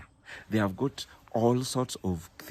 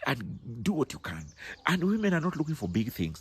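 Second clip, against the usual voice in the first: ordinary talk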